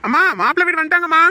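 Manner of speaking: high, loud, rapid call; excited announcement. Acted. Telling mother the groom's family has arrived, fast excited shout. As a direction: rush the big news to mother.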